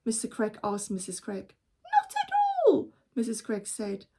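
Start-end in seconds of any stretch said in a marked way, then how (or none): 1.8-2.9 high, light mother voice; mother's voice. Acted. Mother answering her husband, high light voice. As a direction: voice the cheerful mother.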